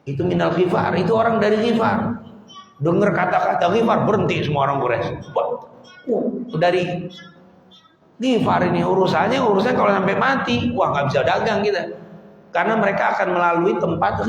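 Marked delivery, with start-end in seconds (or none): none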